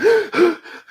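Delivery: loud, high startled gasp. Retold or imitated. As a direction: gasp as if something just exploded. Reliving explosion scare in flight.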